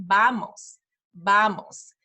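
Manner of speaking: "vamos" starting between B and V; soft B-like V. Shown as a demonstration. Teacher modelling Spanish V for parents, B-like. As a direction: say "vamos" slowly with the lips, almost "bamos". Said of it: "in between a B and a V".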